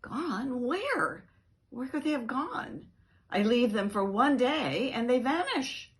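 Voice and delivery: high, loud alarmed questions; panicked disbelief. Acted. Dog panicking that the kittens are gone, high and loud. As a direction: panic at the news.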